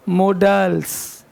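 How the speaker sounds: "मोडल्स" very slow, short schwa in the middle; drawn out "मोडल्स", mo-dəls, no long aa; slow correct pronunciation. Shown as a demonstration. Teacher correcting class, slow careful "मोडल्स". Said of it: "ध्यान देना, मोडाल्स नहीं है".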